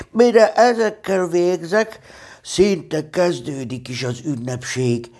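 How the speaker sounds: high, hopeful bear voice. A narrator's reading. Pooh over three pots of honey, hopeful. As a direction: start high and drawn out, easing down hopefully. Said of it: "mondta bizakodva".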